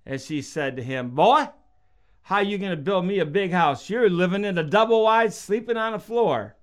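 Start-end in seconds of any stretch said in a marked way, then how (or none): 1.1-6.6 scoffing mama. Retold or imitated. Mother scoffing at her son.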